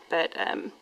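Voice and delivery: ordinary talk, nothing marked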